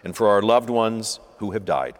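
steady liturgical reading, each petition falling to its close; intercession prayer. Acted. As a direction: lead the congregation's petitions calmly. Leads prayers of the faithful.

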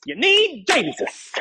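high, drawn-out Southern twang. Acted. Pastor echoing last week's message in a Southern twang. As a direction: stretch it into a playful Southern twang. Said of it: "I love that Southern twang".

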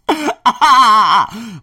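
a burst of loud, high laughter. Real, not acted. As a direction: laugh out loud at your own joke. Loud laugh after joke.

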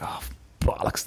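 whispered under his breath, dejected; a voiceless sigh of a curse; muttered curse. Retold or imitated. Walking home after being thrown out, he whispers a curse.

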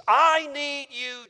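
a slow, loud, high cry of I need you. Retold or imitated. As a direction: worshipper crying out to Jesus, hammed-up plea. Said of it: "they cry out to Jesus".

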